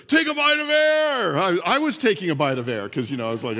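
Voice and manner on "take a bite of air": high, loud imitated coach bellow; megaphone yell. Dad yelling at his scared toddler in the pool, megaphone voice. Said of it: "he kept yelling at, like, megaphone voice".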